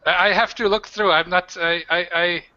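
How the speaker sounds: flustered bartender. Acted. New bartender answers the barmaid, flustered and stammering. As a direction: stumble over the words like a nervous new hire.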